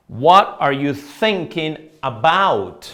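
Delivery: slow, loud repeat for learners; deliberate teacher's demonstration; model example. Shown as a demonstration. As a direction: say it slowly, separating each word. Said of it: "Miren este segundo ejemplo".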